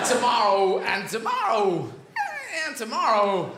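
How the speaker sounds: a hint of Walken's broken rhythm; halting Walken cadence, lightly put on; lightly voiced Christopher Walken monologue; bad Walken impression. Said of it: "This is Christopher Walken".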